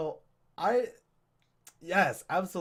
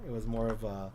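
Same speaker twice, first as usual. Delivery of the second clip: normal talking voice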